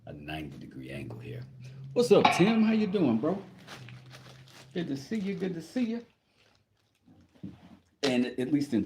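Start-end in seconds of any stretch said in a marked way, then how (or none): 1.9-6.0 high, upbeat shout-out to a buddy; high-pitched hello to a pal; excited greeting. Real, not acted. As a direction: greet a friend brightly, pitched way up. Greeting a viewer who joined.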